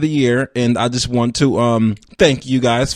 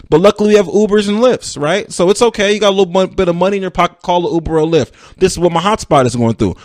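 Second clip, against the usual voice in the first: ordinary talk, nothing marked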